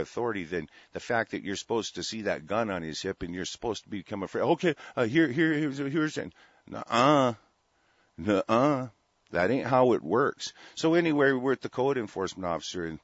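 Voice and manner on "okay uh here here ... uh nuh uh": mock flustered citizen. Ranting host mocks a citizen fumbling for his ID. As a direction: play the scared guy handing over ID, then refuse. Mocking a nervous man showing ID.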